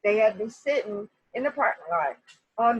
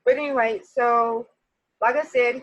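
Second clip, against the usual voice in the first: ordinary delivery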